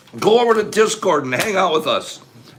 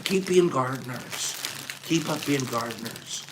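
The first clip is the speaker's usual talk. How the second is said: soft, choked-up sincerity, much softer; emotional, quiet; 3 semitones lower, 9 dB quieter than usual. Real, not acted. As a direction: speak through tears of gratitude. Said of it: "Why I get emotional".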